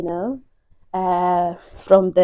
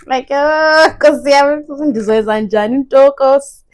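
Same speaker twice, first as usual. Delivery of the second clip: agitated; 6 semitones higher, 8 dB louder than usual. Real, not acted. Panicked about no room.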